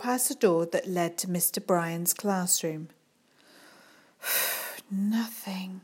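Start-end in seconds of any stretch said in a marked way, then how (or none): 4.2-4.9 breathy disappointed sigh; weary sigh. A narrator's reading. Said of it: "she muttered". 4.9-5.8 whispered grumble under the breath; muttered. A narrator's reading. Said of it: "she muttered".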